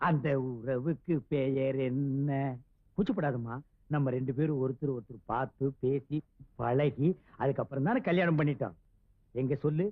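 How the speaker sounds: very low-pitched, intimate husband voice; tender coaxing. Acted. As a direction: coax her gently, deep in the voice. Coaxing shy new wife. (தாழ்ந்த குரலில் கொஞ்சிக் கேட்பது)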